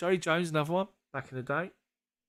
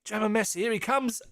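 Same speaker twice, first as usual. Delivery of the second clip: mock hype; 8 semitones higher than usual.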